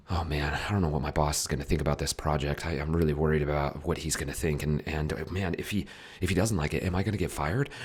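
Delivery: fast, breathless worrying; panicky, quick-tempo chest breathing; anxious rushed talk. Shown as a demonstration. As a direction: rattle off the worries in a hurry. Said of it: "Listen to the tempo of my breath".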